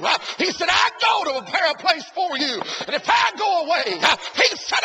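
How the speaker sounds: loud, high revival preaching, shouting to the congregation; preaching at full force. Acted.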